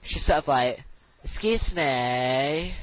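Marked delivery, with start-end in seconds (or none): 1.2-2.7 drawn-out, very slow Scouse farmer; mock a teacher's Scouse accent, stretching the vowels; exaggerated slow Liverpudlian teacher. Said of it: "it's like a Liverpudlian farmer".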